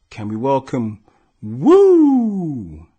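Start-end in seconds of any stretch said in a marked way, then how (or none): 1.4-2.9 loud, high whoop; hype whoop. Real, not acted. Host whooping the boxer onto the podcast. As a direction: whoop the guest in like a hype man. Host hyping guest's entrance.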